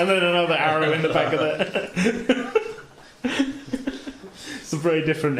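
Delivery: adding the joke through laughter; laughing. Real, not acted. Game master joining the arrow joke, laughing.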